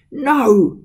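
high, loud shrieked no. A narrator's reading. Master pulling his feet away from the servant, shrieked no. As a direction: shriek it again in protest. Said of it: "He shrieked".

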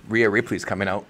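ordinary delivery

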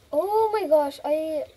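ordinary delivery

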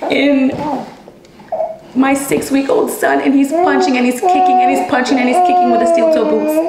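building into upset disbelief; distressed. Real, not acted. A mother recalling her baby beaten with her, rising distress.

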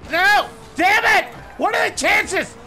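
frustrated shout. Real, not acted. Gamer yelling at a missed goal, frustrated shout. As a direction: shout it out like you just got scored on.

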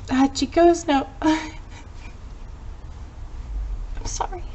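real crying, breaking off mid-word; choked up. Real, not acted. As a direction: break down while reading, then apologise. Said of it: "Miss Barrett's crying".

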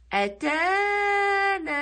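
أتانا with both long aa vowels hugely stretched; long-vowel demo. Shown as a demonstration. Teacher showing the madd, أتانا drawn out very slowly. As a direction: raise the pitch and hold each alif in أتانا, loudly. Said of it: "هنا عندي مد بالألف".

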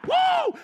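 very high, loud cheering woo; excited whoop. Acted. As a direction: whoop like a fan. Cheering the mighty lion.

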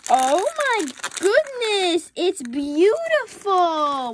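high, slow gushing delight; excited squeal. Real, not acted. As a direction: squeal over the reveal. Reveals a rare bag.

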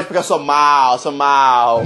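low, playful bad-guy voice; mock villain. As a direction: drop it low like a pretend villain. Playing the mean big brother.

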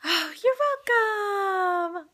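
high, drawn-out tearful reply; real emotion, slow and high. Real, not acted. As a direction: stretch it out high, close to crying. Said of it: "I know crying's good".

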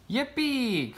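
high falling yippee; excited cry. A narrator's reading. A frog child getting his dream bike, excited high cry. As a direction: cry out in delight. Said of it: "cried Froggy".

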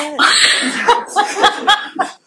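bursts into a loud high laugh; a big incredulous cackle. Real, not acted. Retelling a patient's husband's remark, laughing loudly in disbelief.

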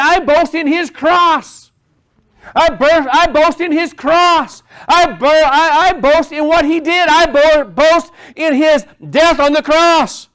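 high, loud, drawn-out declaration; fervent preaching. Acted. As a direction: build it emphatically, each phrase stretched. Preaching climax quoting Paul's boast.

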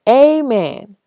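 loud, high church shout; yelled amen. Acted. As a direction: shout it out from the pews. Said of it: "My daddy yelled".

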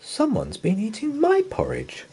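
high motherly bear voice; a puzzled mother bear. Acted. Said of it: "said Mother Bear".

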